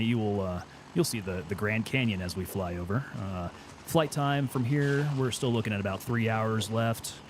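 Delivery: low, easy airline-captain announcement; pilot's voice. Pretend. Captain addressing passengers over the Grand Canyon, low soothing pilot voice. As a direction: do a laid-back pilot on the cabin PA, dropping your pitch. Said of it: "You kinda have a pilot's voice".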